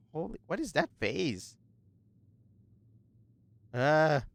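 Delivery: soft, lightly voiced stubborn old woman. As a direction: read her lines quietly, a bit gruff.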